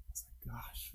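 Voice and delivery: breathy whispered aside; a whispered, rueful gosh. Pretend. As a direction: drop to a whisper, half to yourself. Private sigh at own prayer.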